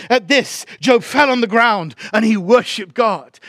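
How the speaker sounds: high, loud scripture proclamation; fervent preaching. Real, not acted. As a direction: build conviction and hammer each phrase to the congregation. Preaches scripture at raised force.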